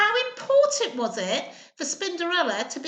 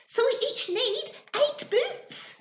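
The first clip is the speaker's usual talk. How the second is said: much higher, slow excited explanation; thrilled child spider; 11 semitones higher, 4 dB louder than usual. Acted. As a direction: announce it with glee. Spinderella excited at answer.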